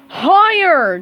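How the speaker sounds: high, loud command; demanding. Acted. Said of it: "demanded Squidward".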